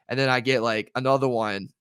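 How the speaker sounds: ordinary talk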